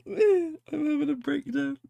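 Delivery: very high, loud, teary laughing through the words; laughing till she cries; crying with laughter. Real, not acted. Laughing so hard she cries over the picture. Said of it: "Oh, I'm crying".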